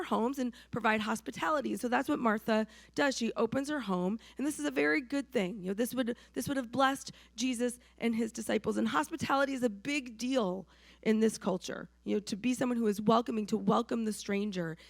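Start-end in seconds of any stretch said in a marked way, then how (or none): none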